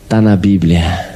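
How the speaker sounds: low, slow, deadpan insistence with a chuckle; slow stubborn mimic. Housemaid stubbornly quoting the Bible to Alexandre, slow and low. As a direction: drawl Tá na Bíblia as if that settles it. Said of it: "Ela só falava assim".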